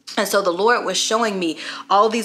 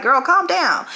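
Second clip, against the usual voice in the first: lightly exasperated, higher-pitched telling-off; mom's mock plea, pitched up; 8 semitones higher, 4 dB louder than usual. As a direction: playfully rein in an overeager girl. Mother voices telling daughter off.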